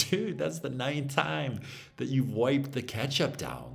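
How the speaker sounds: high, loud retold exasperation; exasperated mock complaint. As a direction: call out your fussy friend in comic disbelief. Exasperated remark to obsessively cleaning friend.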